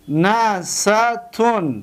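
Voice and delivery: نستون dragged out very slowly; teacher spelling out نستون; slow pronunciation. Shown as a demonstration. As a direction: pronounce نستون syllable by syllable. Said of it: "ببینید".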